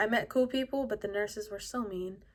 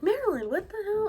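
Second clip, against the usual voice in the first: alarmed mom; 11 semitones higher, 4 dB louder than usual. Retold or imitated. Mom reacts as she opens the pills.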